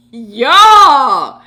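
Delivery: loud, drawn-out holler; big exclamation that swells and falls; amused outcry. Real, not acted. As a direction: yell it out, scandalized and tickled. Cracks up at comment just read.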